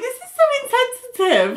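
very high-pitched, amused disapproval; scandalised. Retold or imitated. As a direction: squeal it in mock shock. Shocked reaction to a cruel joke.

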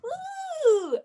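high laughing whoop; giddy high-pitched woo; excited kid whoop. Acted. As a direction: cheer like a little kid scoring. Kids cheering at basketball.

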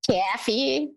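Hoosier accent on "Coffee", vowel fronted to "caffee"; Hoosier-accented "Coffee" with the a of "cat". Shown as a demonstration. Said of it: "there was an accent there".

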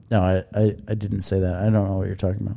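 low, quick mocking mimic of her testimony; imitating her denial to the jury; mocking impression. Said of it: "look to the jury and say".

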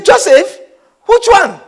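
high-pitched sceptical echo; incredulous query. Retold or imitated. Preacher as Nathanael querying Philip about Joseph, incredulous. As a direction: throw the name back doubtfully.

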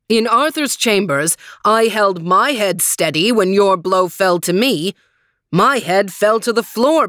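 loud, scornful giant knight; stagey fake archaic declaiming. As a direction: taunt him as a coward. Green Knight taunts.

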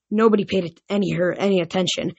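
plain reading voice

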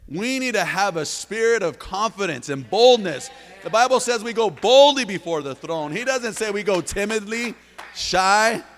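loud, high, driving preacher's cry; full-force preaching. Acted. Pastor rousing the church to step out boldly, full-force preaching.

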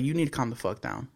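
reenacted calm command, voice kept down; calm, firm retelling. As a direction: say it softly and evenly, like a measured warning. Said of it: "I said it just like that".

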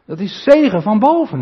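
preaching at full force. Acted. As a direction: sweep them up in Babel's glory.

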